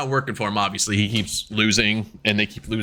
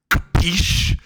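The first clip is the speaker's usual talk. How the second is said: louder, higher questioning punch; mock insistent; 7 semitones higher, 5 dB louder than usual. Team leader repeating himself to confused mates, mock insistent. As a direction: hit it hard as a demand for agreement.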